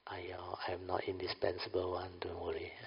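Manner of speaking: soft whispered reassurance; whispering. Retold or imitated. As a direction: whisper a gentle refusal. Gentle refusal quoted.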